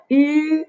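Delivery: YU as the rounded "ü", held high and level; vowel demo. Shown as a demonstration. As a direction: sustain rounded "ü" on one note. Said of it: "pronounce it as".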